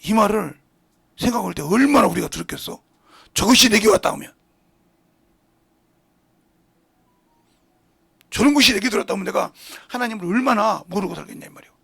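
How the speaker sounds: loud, driving sermon delivery; fiery preaching. Acted. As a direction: preach it out hard to the congregation. Preaching at full force.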